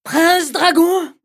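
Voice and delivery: high, loud bride's command. Acted. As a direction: order the dragon boldly. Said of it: "répondit de nouveau la fille".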